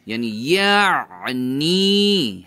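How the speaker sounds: یعنی very slow, wrung out in mid-throat; squeezed ain. Shown as a demonstration. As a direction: stretch یعنی as if squeezing something dry. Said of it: "squeeze کرنا، نچوڑ لینا".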